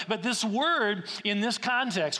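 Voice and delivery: usual speaking voice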